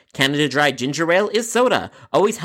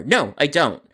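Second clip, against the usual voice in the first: ordinary delivery, nothing marked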